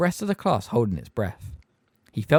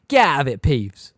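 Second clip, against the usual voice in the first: louder angry brush-off; angry snarl; 4 semitones higher, 7 dB louder than usual. A narrator's reading. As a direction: snap at the pest. Harry snarls at poltergeist.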